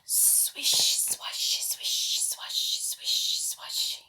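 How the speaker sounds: soft whispered swish swash; whispered sound words. A narrator's reading. Reading to children, whispering the grass sounds. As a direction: hush it like grass brushing past.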